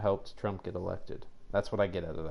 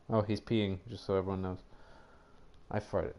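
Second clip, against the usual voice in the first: ordinary talk, nothing marked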